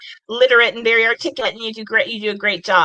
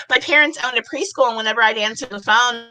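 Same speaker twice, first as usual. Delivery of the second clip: ordinary talk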